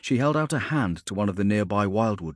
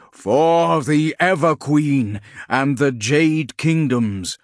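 higher, louder, slow battle roar; roaring war cry; 6 semitones higher, 7 dB louder, much slower than usual. Acted. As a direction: roar it like massed tree giants. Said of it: "rose the roar of the Tree Lords".

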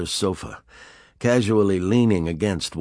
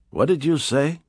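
puzzled Kanai; 8 semitones higher than usual. Acted. Kanai voiced in dialogue.

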